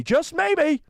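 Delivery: high dramatic tease; teasing. Real, not acted. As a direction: build suspense playfully.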